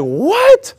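very high, rising mock squeak; acted incredulous yelp; disbelief. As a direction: question it as if dumbfounded. Imitates Oscar's disbelief.

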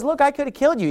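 half-hearted yell to someone far off; mock distant call. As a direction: pitch it up as if calling across a distance. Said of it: "he's yelling from far away".

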